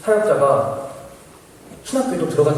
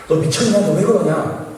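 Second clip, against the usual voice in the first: raised, louder mock scolding; alarmed scolding; 6 semitones higher, 4 dB louder, slower than usual. Colleague warning the student about the gun, scolding. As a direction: imitate an incredulous colleague telling him off.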